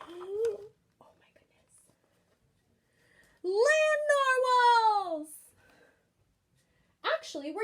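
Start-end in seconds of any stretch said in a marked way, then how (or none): 0.0-1.8 gasp, then a breathy hushed oh my goodness; awestruck, barely getting the words out; gasping wonder. Acted. Kelp gasps on seeing the land narwhals, hushed awe. 3.4-5.3 high, loud burst of delight; excited exclaim. Acted. Kelp spots the unicorns and shouts with excited wonder.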